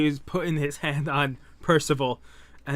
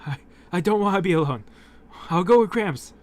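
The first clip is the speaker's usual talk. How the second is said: pleading; 5 semitones higher, 4 dB louder than usual. Acted. Percival begs to stay.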